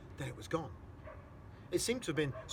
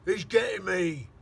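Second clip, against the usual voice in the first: slow, loud, high panicked shout; terrified shout; 8 semitones higher, 15 dB louder, slower than usual. Acted. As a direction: cry out in terror. Giant screaming in nightmare.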